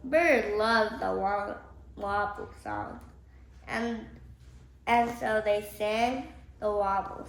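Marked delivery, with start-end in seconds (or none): none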